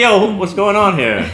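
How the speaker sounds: high, loud bewildered outcry; exasperated exclaim. Retold or imitated. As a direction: cry out like baffled humanity. Acting out confused humans.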